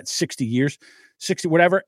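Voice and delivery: usual speaking voice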